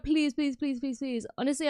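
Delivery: ordinary talk, nothing marked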